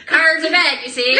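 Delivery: put-on loud, laughing old-timey voice; 1930s voice. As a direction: copy the 1930s voice back. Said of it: "How'd you do that voice?".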